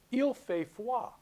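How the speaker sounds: il fait froid said in French, slow and high; French phrase read out; French pronunciation. Shown as a demonstration. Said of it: "French, right?".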